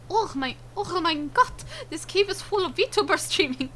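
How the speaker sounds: high, put-on German accent; attempted German accent. Streamer exclaiming about a cave of VTubers, mock German accent. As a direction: cry out in a badly faked German accent. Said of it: "I don't know how to do a German accent".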